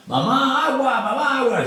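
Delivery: very high wailing plea for water; imitating pleading cries. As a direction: voice a parched prisoner begging his mother. Prisoners crying for water, mother.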